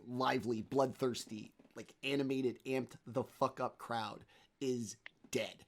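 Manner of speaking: ordinary delivery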